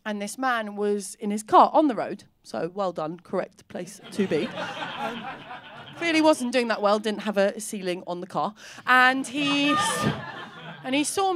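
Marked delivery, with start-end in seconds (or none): none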